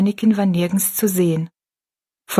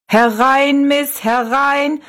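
high, drawn-out showman's call; 7 semitones higher, much slower than usual. A narrator's reading. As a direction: call passers-by into the show. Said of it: "rief er Rosalie zu".

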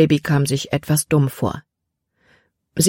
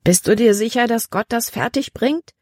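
higher, doubting question; doubtful; 6 semitones higher than usual. A narrator's reading. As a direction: ask it like you can't believe it. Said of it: "bezweifelte sie". (zweifelnd, etwas höher)

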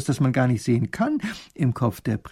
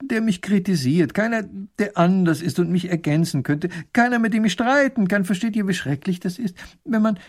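high, plaintive little ruler voice; little grand duke lamenting; 8 semitones higher than usual. Acted. Grand duke lamenting to his court about seeing only himself, plaintive. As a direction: complain in a high, worked-up voice.

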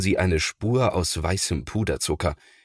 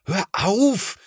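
horrified shout; 13 semitones higher than usual. A narrator's reading. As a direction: stop your little brother in alarm. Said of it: "ruft Thomas entsetzt". (entsetzt rufend)

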